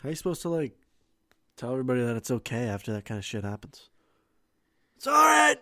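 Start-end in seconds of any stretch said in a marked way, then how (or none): 5.0-5.6 high, loud hollered comfort; hammed-up cry of reassurance; mock reassuring shout. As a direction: absurdly yell your reassurance to a nation. Mock president consoling the nation.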